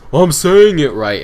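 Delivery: mimicking an indignant student, high and loud; indignant protest. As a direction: protest loudly as the mocked student. Acting out students protesting to tutor.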